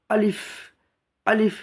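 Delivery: আলিফ said short, without stretching the vowel; brisk, short আলিফ; no elongation. Shown as a demonstration. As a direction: say আলিফ quickly, with no drag. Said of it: "আলিফে কোনো টান হবে না".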